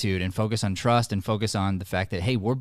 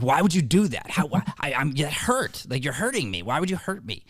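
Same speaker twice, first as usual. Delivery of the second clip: put-on high, upset child's protest; mock wounded child, pitched up; kid's hurt outburst; 6 semitones higher than usual. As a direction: play the hurt, angry kid. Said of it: "You get angry. You're like".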